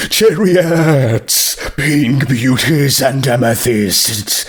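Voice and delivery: slow, awed listing of radishes; lightly voiced zombie, slow and enchanted; zombie stallion. A narrator's reading. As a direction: savour each variety like a connoisseur. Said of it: "zombie ogles the produce".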